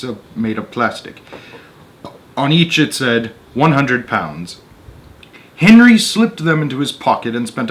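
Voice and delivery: measured read-aloud narrator voice; story narration. A narrator's reading. Reading a Roald Dahl story to an audience, storyteller voice. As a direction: tell the tale steadily to listeners.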